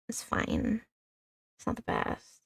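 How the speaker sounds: unvoiced whisper to self; whispered aside. Real, not acted. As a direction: mutter it under your breath. Quiet aside after persevering.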